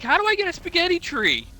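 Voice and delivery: gullible person. Retold or imitated. Fooled Italian viewer asking about spaghetti trees, naive high voice. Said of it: "people were like".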